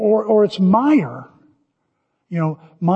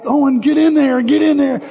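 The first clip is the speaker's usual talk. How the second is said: urging call; 6 semitones higher, 3 dB louder than usual. Retold or imitated. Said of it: "You're like".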